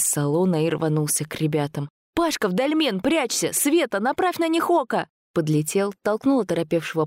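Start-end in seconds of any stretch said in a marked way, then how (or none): 2.1-5.1 high, urgent calling out; urgent orders. A narrator's reading. Man rushing to save friends from creatures, urgent orders. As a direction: fire off orders in alarm.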